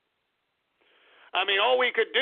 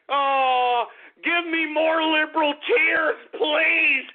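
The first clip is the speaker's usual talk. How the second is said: usual speaking voice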